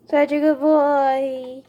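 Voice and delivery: loud, slow, stretched-out praise; lavish the praise on him; doggy praise voice. Real, not acted. Owner praising her service dog again, loud and stretched.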